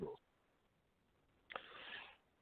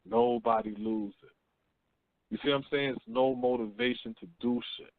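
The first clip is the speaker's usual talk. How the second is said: ordinary talk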